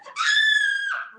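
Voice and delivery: very high loud shriek; girlish scream. Acted. Papa screaming at the sight of the cat, girlish scream. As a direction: shriek like a scared girl. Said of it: "screamed like a girl".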